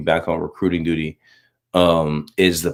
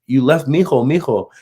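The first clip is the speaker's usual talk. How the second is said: higher-pitched imitation of a worried woman; alarmed woman, imitated; 8 semitones higher, 3 dB louder than usual. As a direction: call out as if alerting a forgetful parent. Woman alarmed about child left inside.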